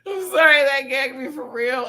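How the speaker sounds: high, loud laugh-crying; real laugh-crying apology. Real, not acted. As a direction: squeal it through tears of laughter. Laughing cry.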